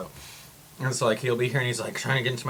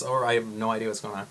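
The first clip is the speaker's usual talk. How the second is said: usual speaking voice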